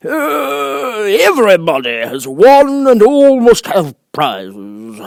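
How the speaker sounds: high, loud, important bird voice; grand old bird holding forth; pompous Dodo. Acted. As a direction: puff up like a self-important Dodo.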